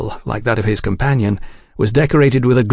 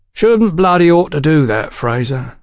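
higher-pitched, calculating tough; calculating warning; 6 semitones higher than usual. A narrator's reading. Seated man warning Fraser off his prey, calculating. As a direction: warn him off with calculation in it. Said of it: "noted a certain calculation evident in his tone".

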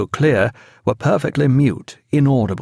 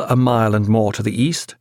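plain reading voice

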